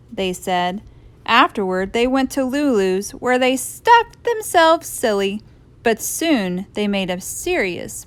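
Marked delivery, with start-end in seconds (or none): none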